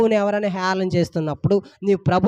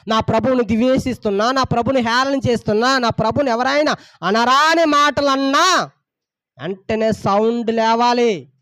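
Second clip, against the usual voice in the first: rising preacher's call, a bit louder; fervent preaching; 3 semitones higher, 3 dB louder than usual. Acted. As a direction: press the flock hard from the pulpit. Pastor preaching to congregation.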